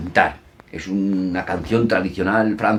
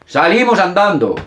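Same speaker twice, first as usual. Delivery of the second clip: playful game call; 10 semitones higher, 6 dB louder than usual. Shown as a demonstration. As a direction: lead the children's game with a big call. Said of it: "y ellos repiten los esquemas".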